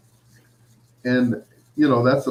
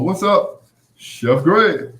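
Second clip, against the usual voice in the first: excited greeting; 7 semitones higher, 5 dB louder, much slower than usual. Retold or imitated. Voicing Keith greeting him.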